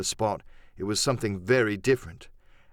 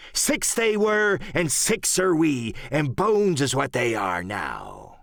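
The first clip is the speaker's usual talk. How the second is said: Silver, ominous pirate leader; 4 semitones higher, slower than usual. Acted. Silver warning his messmates about Flint, slow swooping pirate voice. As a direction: roll out a grim pirate warning.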